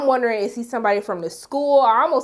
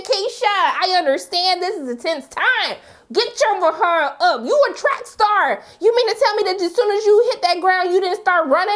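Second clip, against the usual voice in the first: higher, raised scolding at the TV; scolding yell; 7 semitones higher than usual. Retold or imitated. Yelling at Keisha on screen, exasperated scold. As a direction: tell her off from the couch. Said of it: "I was literally yelling".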